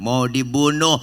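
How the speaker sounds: usual speaking voice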